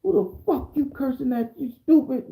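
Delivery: high-pitched acted outburst; indignant comeback, voice jumping way up; lost his cool. Defendant who lost his cool at the judge, acted indignation. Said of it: "So now I done lost my cool".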